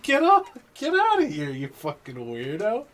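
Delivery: hammed-up get-lost holler; mock shout. Driver mock-yelling at the weird customer to leave, high loud.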